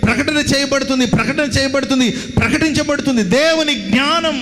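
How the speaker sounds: high, chant-like list of proclamations; fervent preaching. Acted. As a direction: hammer out each phrase, building to the last. Preaching at full force. (ఎత్తైన స్వరంతో లయబద్ధమైన ప్రసంగం)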